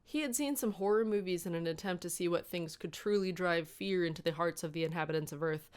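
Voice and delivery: usual speaking voice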